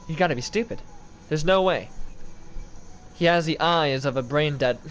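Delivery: normal talking voice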